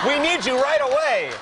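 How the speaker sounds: high, loud urgent app voice; hammed-up high-pitched robot alarm; mock computerized voice. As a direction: blare it like an automated emergency call. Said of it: "Is there, like, a computerized voice?".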